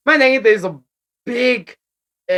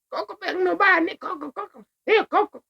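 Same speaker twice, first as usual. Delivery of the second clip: excited phone call; 10 semitones higher, 4 dB quieter than usual. Retold or imitated. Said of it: "I would call and be like".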